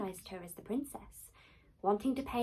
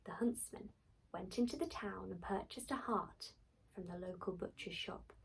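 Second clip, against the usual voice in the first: usual speaking voice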